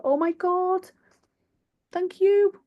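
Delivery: high squeal of gratitude; touched, near tears. Real, not acted. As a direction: squeal your thanks, overcome by praise. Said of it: "Oh, I'm gonna cry".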